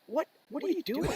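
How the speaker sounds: high, stunned what are you doing; baffled squeak of disbelief; incredulous. Retold or imitated. Teller retelling shock at Wayne in line.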